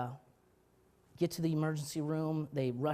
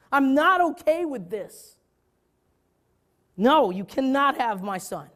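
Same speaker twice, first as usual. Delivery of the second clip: anguished outcry; 10 semitones higher, 10 dB louder than usual. Retold or imitated. Father refusing God his son, anguished and loud.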